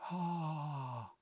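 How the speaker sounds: a tender, breathy movie-audience "aah"; quiet swooning sigh; soft crowd sigh. Retold or imitated. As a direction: sigh like a room swooning at a kiss. Audience sighing at kissing scene.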